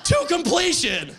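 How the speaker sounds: high, slow punchline yell; outraged exclamation. Acted. Comic on blowjob he turned down, mock-anguished yell. Indignant climax after long low build.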